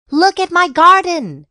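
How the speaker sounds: high, loud cry of outrage; shouting mom. A narrator's reading. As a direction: shout it across the garden. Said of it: "she shouted".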